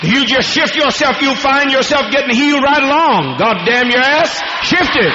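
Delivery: high, pushing voice driving him to move; urgent exhortation. Real, not acted. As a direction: faith healer urges the sick man to shift, high and pressing.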